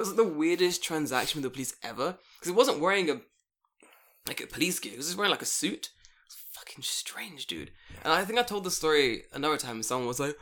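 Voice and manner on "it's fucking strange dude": hushed aside. Real, not acted. Aside on weird encounter.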